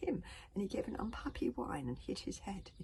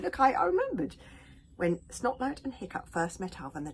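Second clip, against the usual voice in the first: excited; 5 semitones higher, 10 dB louder than usual. Real, not acted. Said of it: "excited aside".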